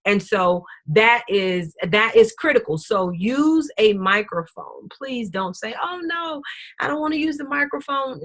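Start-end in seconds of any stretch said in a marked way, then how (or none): 5.8-8.2 high, soft imitated protest; small, high-pitched mock refusal; mock reluctance. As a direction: mimic a reluctant speaker backing away. Mimics someone refusing the microphone.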